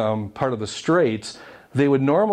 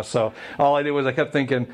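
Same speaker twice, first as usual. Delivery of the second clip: ordinary talk, nothing marked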